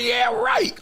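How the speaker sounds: high laugh into a scoffing "Yeah, right"; sarcastic disbelief. Real, not acted. Host scoffs at a guest's Lakers prediction, sarcastic. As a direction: laugh it off as nonsense.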